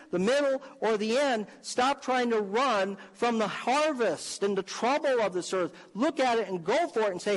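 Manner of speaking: high-pitched, driving exhortation; rally the congregation with punched, urgent commands; full-force preaching. Real, not acted. Preacher urging church to take on the devil, full-force preaching.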